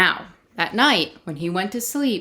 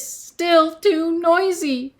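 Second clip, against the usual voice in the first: complaining Peter; 9 semitones higher, slower than usual. Acted.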